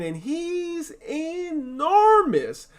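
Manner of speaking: enormous drawn out high and very slow; stretched emphasis. Acted. Spider describing huge pig.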